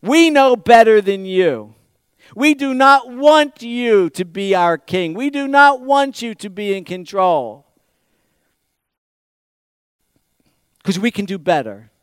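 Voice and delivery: loud, high, stretched-out defiance; defiant declaration. Acted. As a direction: proclaim it forcefully as the rebellious crowd. Preacher voices the defiant people.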